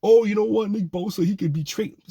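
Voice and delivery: high-pitched mocking imitation of clueless fans; mocking idiots. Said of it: "All those idiots out there that are like".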